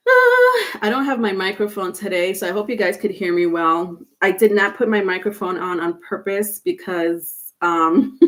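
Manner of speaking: ordinary delivery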